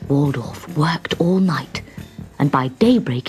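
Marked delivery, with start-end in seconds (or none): none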